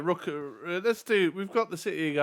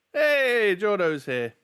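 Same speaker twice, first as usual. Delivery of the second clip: excited greeting; 6 semitones higher, 6 dB louder, much slower than usual. Real, not acted. Greeting a viewer in chat.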